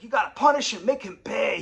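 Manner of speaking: high, loud demand; yelling. Retold or imitated. Father yelling for his son-in-law's punishment. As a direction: push for revenge. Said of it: "He's yelling".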